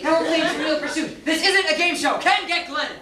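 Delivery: high, impatient scolding; exasperated. Acted. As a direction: put a stop to the silliness. Fed up with guessing game.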